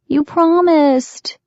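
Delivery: put-on high, slow, forlorn child voice; forlorn little girl. A narrator's reading. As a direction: sad little girl pleading. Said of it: "Cassie's low, forlorn voice".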